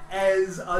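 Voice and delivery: ordinary talk, nothing marked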